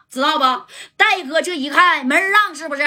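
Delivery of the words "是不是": ordinary delivery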